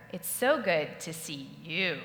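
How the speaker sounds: slow, weight landing on "you"; stress on you. Retold or imitated. Audience member demos stressing "you" to presenter. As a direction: press the word "you" home. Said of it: "Can you do it?".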